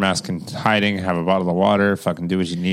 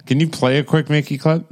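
usual speaking voice

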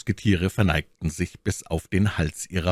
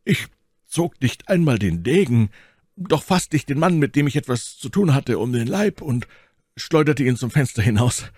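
lightly voiced musketeer, somewhat higher; Athos recounting; 9 semitones higher, 4 dB louder than usual. A narrator's reading. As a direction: recount your deed to a superior. Voices Athos telling of the fight.

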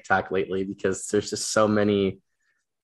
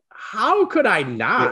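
much higher, slow, stretched-out disbelief; mock incredulous; 12 semitones higher, 4 dB louder, much slower than usual. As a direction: drag it out high like it's obvious. Retelling reply to author, exasperated.

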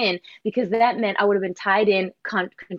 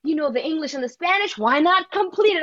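mock producer message; 7 semitones higher than usual. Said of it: "literally got a D M".

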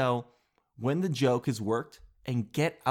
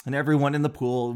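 ordinary talk, nothing marked

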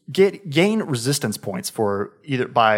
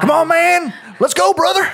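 put-on loud, high Southern good-old-boy holler; Herc; 6 dB louder than usual. Player shows how the hired gun talks, hollering Southern. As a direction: holler like a rowdy Southern buddy. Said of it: "kinda that's how he talks".

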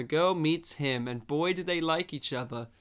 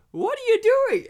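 put-on little girl voice; 8 dB louder, faster than usual. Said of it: "the little girl walks up to him and goes".

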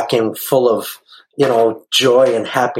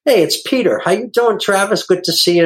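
bright cheery greeting; 7 semitones higher than usual. Retold or imitated.

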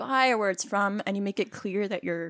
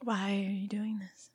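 whisper; 6 dB quieter than usual. Pretend. Acting scared for scary story.

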